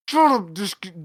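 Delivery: loud scolding; mock snap at the dog. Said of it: "You're like".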